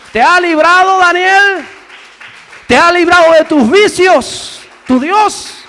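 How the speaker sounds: high, shouted sermon questions; impassioned shouting to the congregation; full-force preaching. Acted. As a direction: preach it at the top of the voice, building to a scream.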